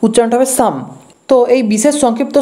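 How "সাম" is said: "সাম" said as "sam", P and L silent. Shown as a demonstration. Teacher showing how psalm is pronounced, silent P and L in "সাম". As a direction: say "সাম" with no P or L sound. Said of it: "পি আর এল এর উচ্চারণ হবে না".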